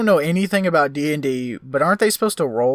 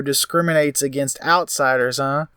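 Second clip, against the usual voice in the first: normal talking voice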